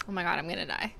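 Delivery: ordinary delivery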